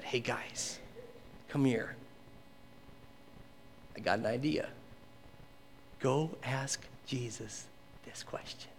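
low, hushed schemer sinking into a whisper; conspiratorial. Acted. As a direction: secretly put words in their mouths. Unseen schemer recruits the questioners.

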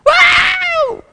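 very high, loud squealing scream; falling scream. Retold or imitated. Boy jumping off the high dive, high scream. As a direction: shriek all the way down. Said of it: "I probably screamed like a girl".

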